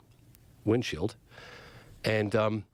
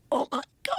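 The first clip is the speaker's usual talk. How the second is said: awestruck. Retold or imitated. Camera guy sees the disc.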